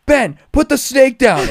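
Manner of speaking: high, loud, slow plea; retold yell. Chased by coworker holding a snake, high slow loud plea. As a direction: yell it while running away. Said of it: "And I'm like".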